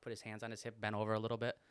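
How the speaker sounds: ordinary talk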